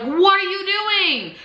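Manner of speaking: high, slow stretched challenge; indignant demand. Retold or imitated. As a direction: call her out across the lot. Confronting driver again.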